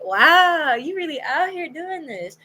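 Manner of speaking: high, drawn-out retold "wow"; amazed. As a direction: act out being impressed. Retold amazement at his job.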